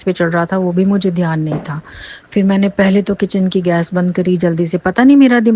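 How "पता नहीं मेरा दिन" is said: higher, louder burst of panic; agitated outburst. Real, not acted. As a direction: blurt it out in disbelief. Reliving the panic.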